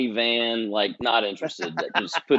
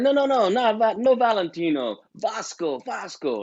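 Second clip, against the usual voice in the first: insistent correction; 9 semitones higher than usual. Retold or imitated. Retold Italian caller corrects him insistently.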